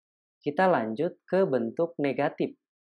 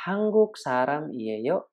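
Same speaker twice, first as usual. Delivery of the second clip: slow Korean demo; 2 semitones higher, slower than usual. Shown as a demonstration. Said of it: "Contoh ya".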